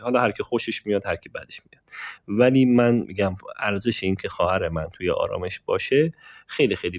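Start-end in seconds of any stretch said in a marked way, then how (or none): 1.1-1.8 voiceless whisper, half to himself; whispered aside. Real, not acted. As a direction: drop to a whisper as if sharing a secret. Whispered aside about family.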